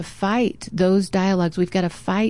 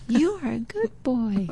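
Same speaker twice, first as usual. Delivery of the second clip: the compliment returns softer; faint echo; 4 semitones higher, 5 dB quieter than usual. Retold or imitated. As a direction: echo it back from far away. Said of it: "He heard an echo".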